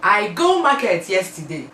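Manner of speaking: plain Nigerian Pidgin example. Shown as a demonstration. Teaching viewers a Nigerian Pidgin sentence, lightly voiced. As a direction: state the Nigerian Pidgin line simply. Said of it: "you say".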